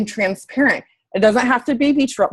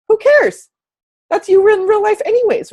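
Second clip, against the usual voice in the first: much higher and louder, tossing the worry away; dismissive exclamation; 10 semitones higher, 4 dB louder than usual. Real, not acted. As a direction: wave it off loudly. Brushing off fears, emphatic.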